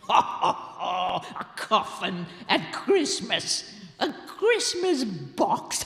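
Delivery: slow, relishing laughter through the words; gloating laugh. Acted. Sexton laughing to himself about a coffin, gloating. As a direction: chuckle darkly over a grim joke. Gabriel gloats after his song.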